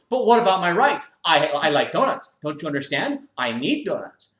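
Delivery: high, loud self-righteous whine; whiny gym member. Acted. Member defends his mess to the owner, loud high whine. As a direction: complain like a spoiled man-child.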